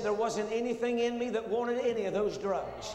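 high, loud preaching climax; preaching at full force. Acted. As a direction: lift the whole testimony into a loud, high preacher's cry. Preacher builds testimony to climax.

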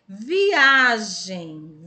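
viagem with a long, loud A; stress on A. Shown as a demonstration. Teacher showing pupils the strong A in viagem, stress on A. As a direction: punch and stretch the middle A of viagem. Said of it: "Apenas o A".

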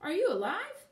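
high, loud, swooping disbelief; startled, rising question; astonished question. A narrator's reading. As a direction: ask in amazed wonder. Scarecrow startled by speaking statue.

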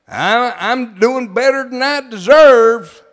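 slow, drawn-out pious humility; mock humble reply. Preacher mimicking a falsely humble churchgoer, slow and drawn out. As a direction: play the falsely humble believer. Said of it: "the way the old man thinks".

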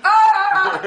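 laughing shriek. Real, not acted.

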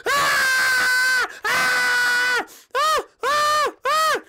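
piercing high-pitched screams; furious screaming. Acted. Peach attacking her fans, enraged screams. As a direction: shriek repeatedly, very high and loud.

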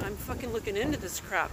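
ordinary talk, nothing marked

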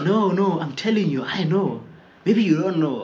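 a hint of a know-it-all; mock insistent coworker. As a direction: insist like the stubborn guy.

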